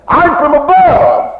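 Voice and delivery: preacher's triumphant cry; ringing proclamation. Real, not acted. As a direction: lift it up and declare it to the church. Preacher proclaims his heavenly origin.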